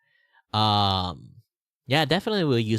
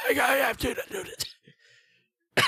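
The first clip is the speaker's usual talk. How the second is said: put-on slow, out of breath old man; strained old man voice; much slower than usual. Shown as a demonstration. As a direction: struggle through it like a frail old man. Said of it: "I'd have to be like this".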